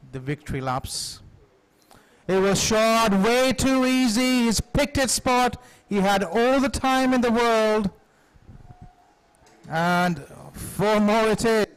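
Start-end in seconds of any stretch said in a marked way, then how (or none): 2.3-11.7 excited commentary. Real, not acted. Boundary hit, commentator raises voice.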